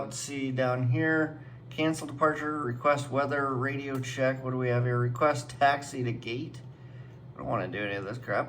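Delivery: usual speaking voice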